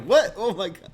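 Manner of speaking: very high, loud hammed-up disbelief; playfully exaggerated amazement; mock astonishment. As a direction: shriek it out as if stunned. Retold amazed reaction.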